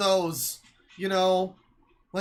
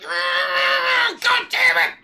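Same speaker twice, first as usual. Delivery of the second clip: much higher, loud exasperated cry; mock frustrated yell; 13 semitones higher, 6 dB louder, much slower than usual. Imitating his own outburst at the video, loud exasperated cry. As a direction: blurt it out like stubbing a toe. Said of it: "And it's like".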